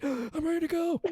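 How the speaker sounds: high-pitched caffeine-buzz quote; mock wired excitement. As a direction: act out being instantly wired. Retold buzz from smelling coffee.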